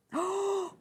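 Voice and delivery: high intake of breath; delighted gasp. Real, not acted. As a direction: gasp in admiration at what you see. Delighted at a fabric.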